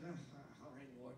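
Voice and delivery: barely audible reluctant mumble; soft reluctant giver; grudging sigh. Retold or imitated. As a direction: sigh it out unwillingly to God. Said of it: "If you're like".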